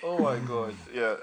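ordinary delivery

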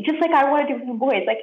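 usual speaking voice

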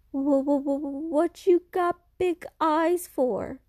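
frightened stammer. Acted. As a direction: stutter in fear at the creature. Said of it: "The boy is so frightened".

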